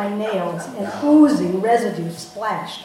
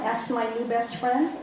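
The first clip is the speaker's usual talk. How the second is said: ordinary delivery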